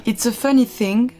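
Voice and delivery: slow English in a French accent. Real, not acted. Testing her English by reading Matilda aloud, French accent. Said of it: "j'ai un accent de merde".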